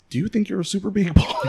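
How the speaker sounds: high-pitched question tipping into laughter; laughing tease. Real, not acted. Host asking guest about being superhuman, laughing tease. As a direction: tease him, voice climbing into a laugh.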